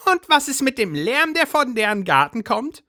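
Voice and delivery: high agitated housewife voice; Brigitte's voice. A narrator's reading. Brigitte complains.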